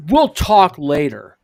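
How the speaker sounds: curt Tim. Retold or imitated. Tim curtly ending call.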